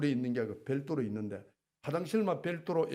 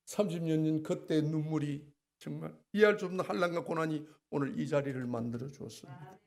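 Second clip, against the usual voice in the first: usual speaking voice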